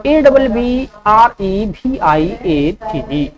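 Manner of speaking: slow letter-by-letter dictation; slow spelling dictation; spelling out slowly. Shown as a demonstration. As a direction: spell each letter clearly for students. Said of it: "abbreviate का spelling होता है".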